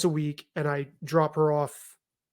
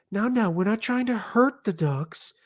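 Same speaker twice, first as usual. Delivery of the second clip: higher, timid mock-mother voice; timid mom; 4 semitones higher, 3 dB quieter than usual. Mom to boys throwing rocks at ducks, timid and higher. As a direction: imitate a timid parent meekly pleading. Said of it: "this incredibly timid voice".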